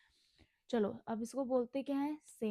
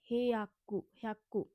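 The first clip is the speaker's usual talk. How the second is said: normal talking voice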